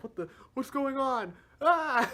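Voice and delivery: high, loud startled yelp; startled panic. Retold or imitated. Revived Ozma waking in confusion, high alarmed yelp. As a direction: jolt awake in alarm and cry out.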